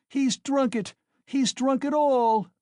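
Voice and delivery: high, loud wail, slowing on all; mother screaming that the money is drunk away; despairing scream. A narrator's reading. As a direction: scream out her ruin. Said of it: "the poor woman screamed in despair".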